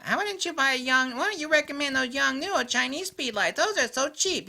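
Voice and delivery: high mock-questioner voice; mocking pesterer. Mimics people who keep asking him.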